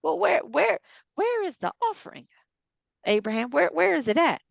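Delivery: high, insistent, stammered questions; puzzled questioning. Acted. Followers pressing Abraham about the offering, puzzled questioning.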